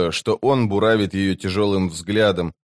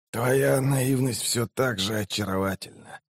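soft wheeze of a wounded man; wheezed, dying; 7 dB quieter than usual. A narrator's reading. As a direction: wheeze it quietly with failing strength. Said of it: "прохрипел он". (тихий хрип умирающего)

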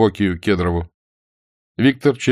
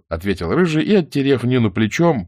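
ordinary delivery

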